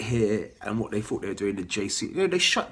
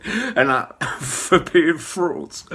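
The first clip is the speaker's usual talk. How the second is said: laughing; 7 semitones higher, 6 dB louder, much slower than usual. Real, not acted. Laughs at own rant.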